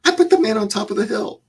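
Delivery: high-pitched, pleading objection; imitated high whine of protest. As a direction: argue back as the frustrated trainee, voice way up. Acted protest to his instructor.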